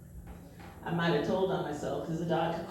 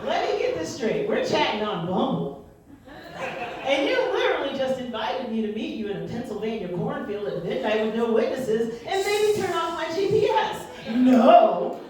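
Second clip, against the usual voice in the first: incredulous outrage; 5 semitones higher, 8 dB louder than usual. Acted. Incredulous rant at date.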